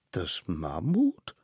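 MAMMUT drawn out, rising at the end; disbelieving question. Acted. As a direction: echo MAMMUT as a doubtful question. Children question the named witness. („Mammut“ ungläubig fragend, nach oben gezogen)

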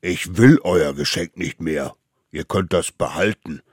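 loud, stern refusal; rising anger. A narrator's reading. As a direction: reject it firmly, holding some anger. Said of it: "spürte Zorn in sich aufsteigen". (laut und grollend)

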